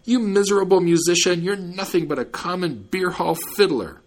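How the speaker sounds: high, angry storyteller's yell. A narrator's reading. Robbed man curses the departing fiddler, yelling. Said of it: "he yelled with all his might".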